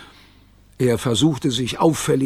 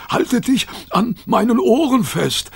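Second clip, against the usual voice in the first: higher, excited instruction; urgent dog voice; 10 semitones higher than usual. A narrator's reading. Rover warning Kayla before running, high urgent voice. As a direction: call it out ready to bolt.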